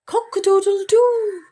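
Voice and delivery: very high, loud crowing call; rooster crow. Acted. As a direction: crow it out like a proud rooster at dawn. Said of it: "crowed the rooster".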